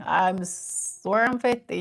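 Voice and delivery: ordinary delivery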